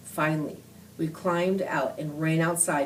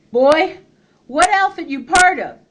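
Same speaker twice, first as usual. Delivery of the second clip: much higher, louder harsh bark; 10 semitones higher, 9 dB louder than usual. A narrator's reading. Confederate leader interrogating boy, harsh loud bark. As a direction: demand an answer, no patience. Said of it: "their leader barked".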